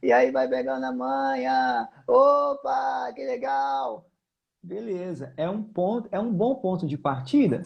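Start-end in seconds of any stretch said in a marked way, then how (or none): 0.0-1.9 low, slow vocal fry; creaky fry voice. Shown as a demonstration. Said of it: "essa crepitância do fry". 2.1-4.1 excited. Pretend. Pretend student reacting excitedly.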